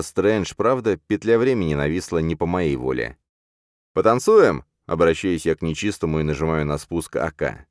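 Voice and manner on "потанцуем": high, loud playful challenge; cocky taunt. Acted. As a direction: tease him before firing. Hero taunts before shooting. (дерзко-игриво)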